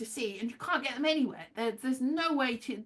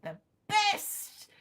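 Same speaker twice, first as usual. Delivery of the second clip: "the best" jumped much higher with a rising tune; high exclaim; 10 semitones higher than usual. Acted. Whisper then high repeat.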